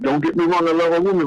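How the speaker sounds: ordinary talk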